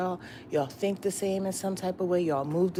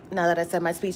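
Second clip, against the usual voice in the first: ordinary talk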